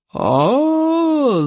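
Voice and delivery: high, drawn-out chieftain's roar; roaring yell. A narrator's reading. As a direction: roar as you walk in on the mess. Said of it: "заорал стоик обширный". (рёв вождя)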